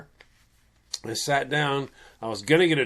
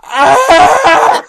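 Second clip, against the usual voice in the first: high, loud laughing shriek; panicked scream; 13 semitones higher, 14 dB louder than usual. Retold or imitated. Fleeing the band girl, high loud panicked shriek. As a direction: shriek and run off. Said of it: "Me going".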